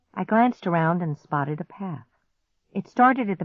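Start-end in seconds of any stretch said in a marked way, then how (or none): none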